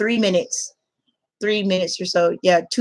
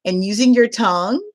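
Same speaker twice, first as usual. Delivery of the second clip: ordinary delivery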